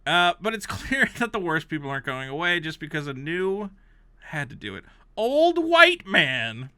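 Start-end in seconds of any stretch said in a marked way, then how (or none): 5.1-6.7 slow savoring. Real, not acted. Relishing the review's phrase, slow drawn-out read. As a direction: stretch each word out.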